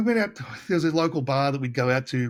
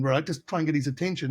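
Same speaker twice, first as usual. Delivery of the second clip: ordinary talk, nothing marked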